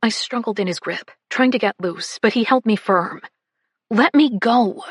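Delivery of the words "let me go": angry. Acted. Struggling in his grip.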